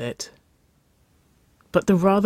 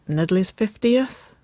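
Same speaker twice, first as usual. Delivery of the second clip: higher, tentative question; murmured; 7 semitones higher, slower than usual. A narrator's reading. Woman recalling where she wore it, murmuring. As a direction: say it slowly, rising, a little sheepish. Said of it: "Waverley murmured".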